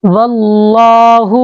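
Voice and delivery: "واللہ" chanted slowly with a thick, dark L; heavy L. Shown as a demonstration. Teacher reciting Quran for students, "واللہ" with a heavy L. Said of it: "لام کو موٹا کر کے پڑھیں گے".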